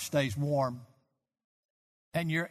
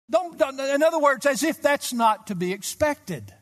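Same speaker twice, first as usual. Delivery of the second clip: higher and louder, driving pulpit delivery; impassioned preacher pressing the point home; rising preaching; 7 semitones higher, 5 dB louder than usual. Acted. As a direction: build the sermon into fervent urgency. Preaching at full force.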